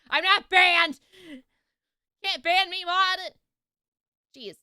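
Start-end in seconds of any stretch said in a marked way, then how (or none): 0.1-3.2 playful shout. Real, not acted. Playful taunt at mod.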